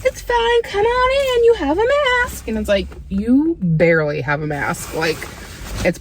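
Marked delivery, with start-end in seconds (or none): 0.1-2.5 much higher, airy welcoming voice; hammed-up chirpy receptionist; mock breezy staff. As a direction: wave them in like careless staff. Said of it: "places are like".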